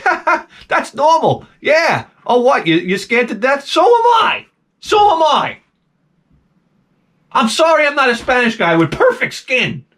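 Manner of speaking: high, strained fake cheer; nervous high fake cheer at the scared barber; nervous mock laugh. Mock reassuring bleeding barber.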